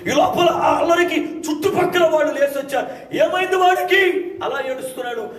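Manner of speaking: high, loud revival preaching; preaching at full force. Acted. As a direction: proclaim it to the whole congregation.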